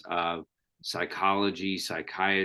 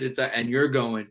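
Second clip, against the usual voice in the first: usual speaking voice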